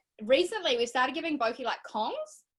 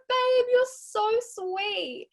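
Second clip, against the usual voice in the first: much higher and slow, choking up a little; teary and touched; 11 semitones higher, 3 dB louder, much slower than usual. Retold or imitated. To her partner, tearing up with happy emotion. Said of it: "I got so happy that I just teared up".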